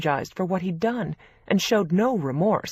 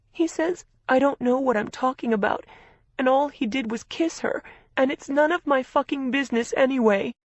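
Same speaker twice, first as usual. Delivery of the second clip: crying; 9 semitones higher than usual. A narrator's reading. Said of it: "She was crying".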